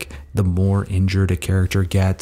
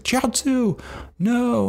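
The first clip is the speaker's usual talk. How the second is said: much higher, strained mock cry of no; pleading cry; much slower than usual. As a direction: cry out to stop him, pitched way up. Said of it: "and Tien's like".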